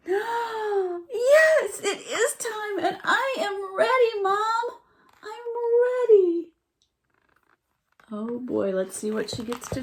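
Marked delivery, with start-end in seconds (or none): 0.1-6.5 very high, loud joyful cry; overjoyed fawn. A narrator's reading. Fawn telling Mom he is ready, high and joyful. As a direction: shout it out bursting with joy.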